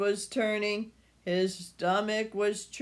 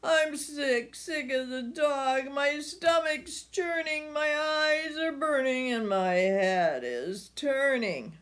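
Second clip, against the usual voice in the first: higher, whiny sick voice; sick son; 6 semitones higher than usual.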